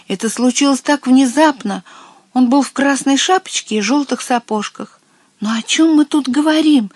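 high, agitated miller; flustered account. A narrator's reading. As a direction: tell it in a fluster, pitch up. Flustered miller describes the culprit.

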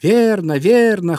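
high, loud cries of agreement; two ringing high-pitched cheers; birds crying in chorus. A narrator's reading. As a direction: call out like a flock agreeing. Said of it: "хором закричали птицы".

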